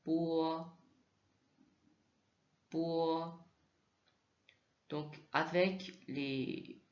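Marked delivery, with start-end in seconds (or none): 0.1-3.5 "Bois" said as an unaspirated "pu"; mandarin b. Shown as a demonstration. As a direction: say "Bois" as a crisp "pu". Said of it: "mais c'est pour".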